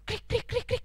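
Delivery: high staccato chirps; squeaky little cricket noises; cricket chirping. Acted. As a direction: imitate a cricket's song. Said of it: "bisa nyanyi".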